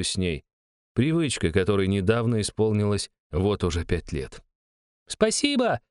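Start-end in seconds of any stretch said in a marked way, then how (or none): 5.1-5.8 put-on high, bright child's thank-you call; shouting little girl. A narrator's reading. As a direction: call out thanks like a happy kid. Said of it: "крикнула девочка".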